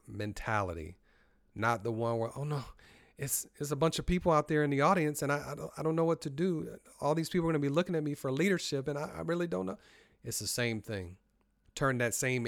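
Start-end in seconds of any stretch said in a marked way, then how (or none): 2.3-9.8 high, hurried, stammering worry; anxious negative self-talk. Shown as a demonstration. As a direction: fret nervously to yourself, pitched up. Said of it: "tell the difference in my voice".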